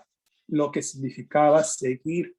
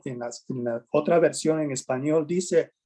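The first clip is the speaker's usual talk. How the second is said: normal talking voice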